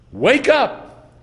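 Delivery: urgent wake-up cry; emphatic command. Retold or imitated. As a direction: rouse the sleepers sharply. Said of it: "It's an imperative, emphatic".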